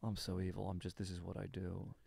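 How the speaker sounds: low, soft, resigned villain; Philip Seymour Hoffman. Acted. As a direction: confess evil in a hushed low voice. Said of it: "It's his low point".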